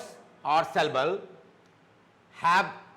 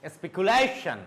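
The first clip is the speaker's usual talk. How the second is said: speculation with LA raised, then falling on tion; stress on LA. Shown as a demonstration. Teacher drilling T I O N words, stress on LA. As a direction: punch the LA in speculation. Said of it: "it should go up".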